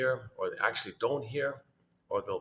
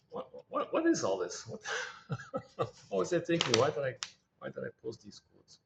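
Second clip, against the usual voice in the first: baffled self-questioning, breaking into a laugh; stammered "what, what", laughing at yourself; mock disbelief; 3 semitones higher than usual. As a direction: question your own past self in amused bewilderment. Mocks own past self in disbelief.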